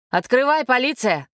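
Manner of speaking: mild shout at the door; police demand. A narrator's reading. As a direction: call out firmly through a closed door.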